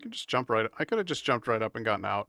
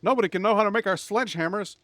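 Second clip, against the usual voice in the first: high, frantic mock voice for hiding the books; panicked game characters; 11 semitones higher, 5 dB louder than usual. Streamer mocking game characters, in a frantic high mock voice. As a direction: squeal it out like scared villagers. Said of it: "They're like".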